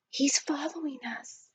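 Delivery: frightened, barely voiced whisper; scared whisper. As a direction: breathe it so the miner won't hear. Scared boy whispers to the butler.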